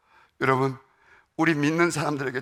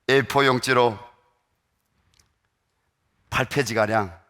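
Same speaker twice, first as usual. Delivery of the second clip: normal talking voice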